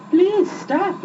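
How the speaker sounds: high pleading horse. A narrator's reading. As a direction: beg him to stop. Horse begs the boy.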